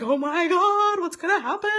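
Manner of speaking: high loud nervous gasp; anxious panic. Retold or imitated. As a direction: panic as the phone rings. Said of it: "I was like".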